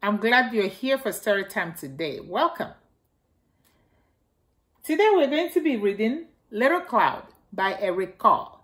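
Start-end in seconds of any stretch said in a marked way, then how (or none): none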